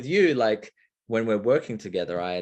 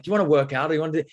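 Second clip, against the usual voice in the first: louder, higher keen offer; eager invitation; 6 semitones higher, 6 dB louder, much faster than usual. Retold or imitated. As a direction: pitch the idea with energy. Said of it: "I'd be like".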